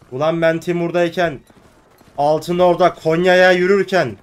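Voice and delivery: furious rant. Real, not acted. Gamer ranting at the Timurid faction, furious loud scolding. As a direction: berate the traitors at full volume.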